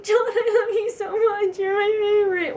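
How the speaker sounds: high, loud gushing, near tears; overwhelmed fan gushing. Pretend. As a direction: gush at your idol, almost crying. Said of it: "be like".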